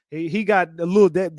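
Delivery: ordinary talk, nothing marked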